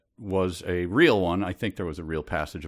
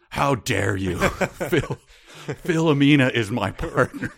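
mock offended; 5 semitones higher, 3 dB louder than usual. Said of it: "And she goes".

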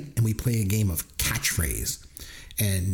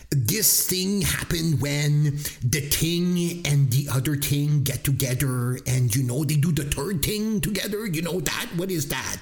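slow, halting mock French Canadian accent; a lightly done French Canadian mom, groping; 3 semitones higher, slower than usual. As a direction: imitate a Quebec mom groping for English words. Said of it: "your insensitive French accent".

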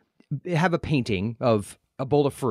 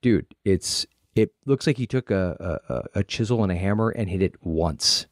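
lower, deadpan dude; 7 semitones lower than usual. Retold or imitated. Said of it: "you'd be like".

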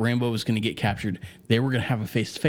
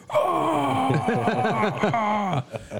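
a laughing, higher shouted battle cry; mock war yell; 10 semitones higher than usual. Shown as a demonstration. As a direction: yell like Rambo firing the big gun. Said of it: "can shoot harder ... when they yell".

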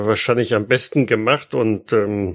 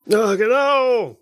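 high-pitched cheering bystander; crowd heckler; 11 semitones higher, slower than usual. Acted. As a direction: shout approval from the crowd. Crowd member agrees.

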